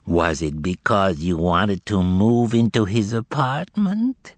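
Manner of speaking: slow, sweetly nasal and falsely polite; cordial nasal twang. Acted. Demon questioning the frightened informer, cordial nasal twang. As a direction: ooze mock courtesy through the nose. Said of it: "in his most cordial nasal twang".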